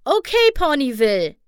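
high rallying call to a crowd. A narrator's reading. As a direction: call out to the whole town. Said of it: "rief Applejack".

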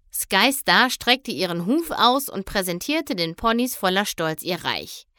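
usual speaking voice

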